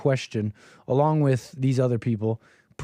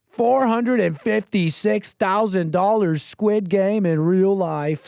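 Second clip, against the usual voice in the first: slow, higher-pitched hype read; mock title announcing; 5 semitones higher, slower than usual. Commentator mocking Squid Game video title, drawn-out announcing. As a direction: stretch it out high, like a big reveal.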